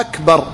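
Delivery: أكبر with its first vowel held long, "aaakbar"; lengthened hamza of أكبر. Shown as a demonstration. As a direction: stretch the opening "a" of أكبر, then close it short. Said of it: "يعني مد همزة".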